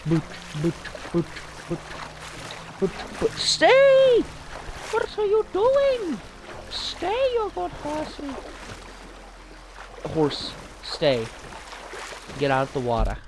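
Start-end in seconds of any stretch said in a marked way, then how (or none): 3.3-8.3 squeaky high voice, slowed down; high-pitched pleading. Acted. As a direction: coo at the horse in a high voice. Calling to his horse.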